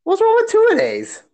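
very high, whiny protest; hammed-up squeaky disbelief; mock dismay. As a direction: squeal it as if personally wounded. Protesting the advice against two-a-days.

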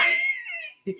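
a burst of high squealing laughter; sudden high laugh. Real, not acted. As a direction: caught herself and cracks up. Laughing at own admission.

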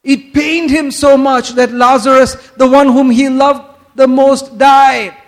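mock sentimental answer. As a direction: gush as if retelling a touching tale. Mimicking the common sentimental answer.

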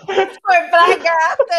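laughing. Real, not acted. Explaining while still laughing.

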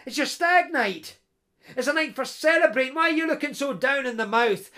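jolly him out of his gloom; hearty best pal. Acted. Best pal ribbing the gloomy laird, jovial and loud.

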